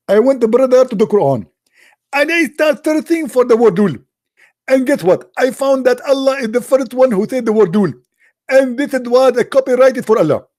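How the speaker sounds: loud, very high-pitched mock proclamation; mock excited squeal. Arabian guy reporting his lul investigation in the Quran, mock excited squeal.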